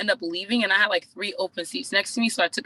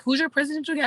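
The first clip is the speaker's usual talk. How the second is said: higher-pitched quote of another coach, lightly put on; imitated eager coach; 8 semitones higher, faster than usual. Coach asks her about her leader, lightly imitated eagerness. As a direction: lightly voice a coach suddenly curious. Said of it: "she was like".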